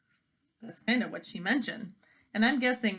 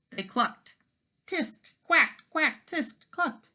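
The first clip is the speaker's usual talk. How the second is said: clucking and quacking; 6 semitones higher, much slower than usual. A narrator's reading. Narrator imitates hens and duck.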